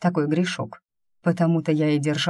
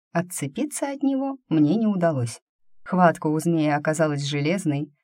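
plain reading voice

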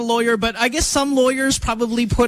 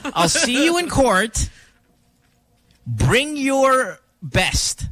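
slow dramatic threat; 5 semitones higher, much slower than usual. Retold or imitated. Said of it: "So he goes".

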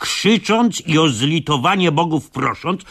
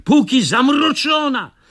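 yelling; 10 semitones higher than usual. Acted. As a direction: scream at them to run. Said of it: "wrzasnął ja do nich".

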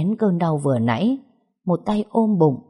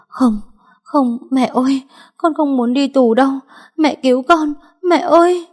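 much higher, louder, sobbing cry of terror; crying out in panic; 10 semitones higher, 6 dB louder than usual. Acted. Daughter begging her mother to save her from prison, hysterical crying wail. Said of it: "vừa khóc vừa la lên".

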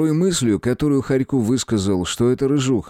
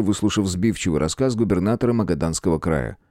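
ordinary delivery

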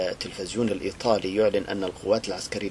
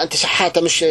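loud, higher scornful accusation; sneering tyrant; 8 semitones higher, 8 dB louder than usual. Retold or imitated. Dictator accusing the woman of begging, loud sneer. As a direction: jeer at her like a beggar. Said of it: "قال لها".

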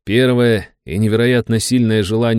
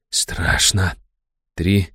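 frightened hush; 3 semitones lower, much slower than usual. A narrator's reading. Fear while counting underwater.

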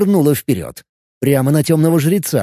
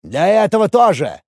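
shouting priest; 6 semitones higher than usual. Acted. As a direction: bark the order down at a cornered enemy. Dark priest answers mockingly.

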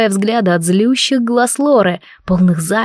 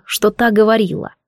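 usual speaking voice

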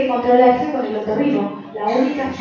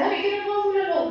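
mock scared patient; 7 semitones higher, 3 dB quieter than usual. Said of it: "mucha gente viene asustada y dice".

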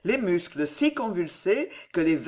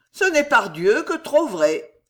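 higher, louder, affected nonchalance; nonchalant; 5 semitones higher, 6 dB louder than usual. A narrator's reading. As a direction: confirm it airily in a raised voice. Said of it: "d'une voix nonchalante". (voix nonchalante et haut perchée)

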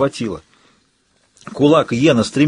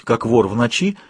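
ordinary talk, nothing marked

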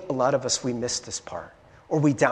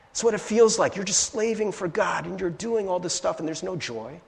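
ordinary talk

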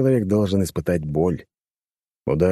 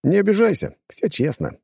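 mock apologetic; 12 semitones higher than usual. A narrator's reading. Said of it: "развёл я руками".

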